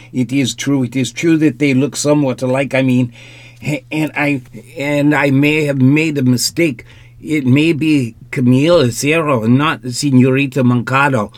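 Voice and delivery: put-on fast, anxious pleading with a stronger accent; rapid, flustered. A narrator's reading. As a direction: tumble the words out in a panic. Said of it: "very rapidly, so that his accent ... became more pronounced".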